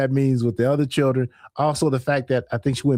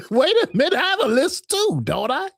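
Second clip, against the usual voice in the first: higher, laughing through the words; 13 semitones higher than usual. Real, not acted. Host asks chat about her lisp, laughing. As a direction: giggle while checking your own lisp. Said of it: "Y'all can't hear the lisp?".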